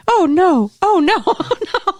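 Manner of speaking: high, loud comic dread; hammed-up rising alarm with laughter; mock panic. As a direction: wail in pretend horror at what's coming. Retells Mark's panic at the scene.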